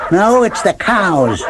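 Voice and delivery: high, slow yelp of a small dog; lightly yelped high dog line. Acted. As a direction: Queenie yelps an accusation. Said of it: "Queenie yelped".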